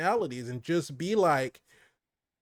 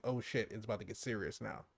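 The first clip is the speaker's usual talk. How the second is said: low, much softer aside; lowered serious tone; 5 semitones lower, 13 dB quieter than usual. Retold or imitated. Describing the villain turning menacing, voice lowered and serious. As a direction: drop the voice low and quiet. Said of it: "When he lowers that tone".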